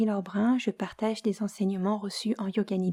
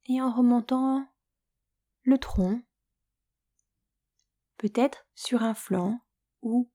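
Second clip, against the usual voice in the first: guided Yoga Nidra; 2 semitones higher than usual. Acted. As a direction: lull the listener toward sleep, unhurried and hushed at times. Leads Yoga Nidra for sleep.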